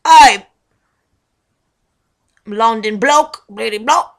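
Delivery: loud blokey London accent; hammed-up London bloke, punched out. Shown as a demonstration. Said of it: "type of blokey bloke".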